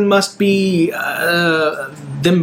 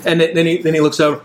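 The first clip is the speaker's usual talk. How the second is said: ordinary talk, nothing marked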